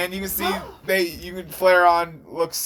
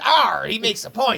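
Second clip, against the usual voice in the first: pirate arr; 7 semitones higher than usual. Acted. Pirate Dex conceding the point, higher hammy pirate voice. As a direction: play the pirate grudgingly agreeing. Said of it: "he goes".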